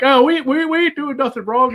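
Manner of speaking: high-pitched defensive protest; loud, flustered excuse, imitated; mock innocent denial. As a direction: play caught-out guys claiming innocence. Acting out guilty men denying it.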